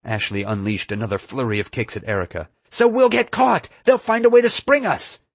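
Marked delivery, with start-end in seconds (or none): 2.7-5.2 high, loud, insistent yell; very high-pitched, heated shout; frantic shouting. A narrator's reading. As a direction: yell it back in desperate protest. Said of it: "screaming at Nefarious the entire time".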